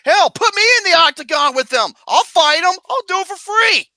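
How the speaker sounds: high, loud, laughing boast; volunteer eagerly for the fight; shouting to be put in the octagon; excited boast. Retold or imitated. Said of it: "I was just like".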